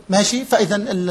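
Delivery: normal talking voice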